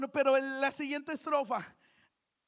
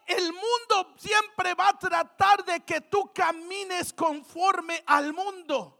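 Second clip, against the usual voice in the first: ordinary talk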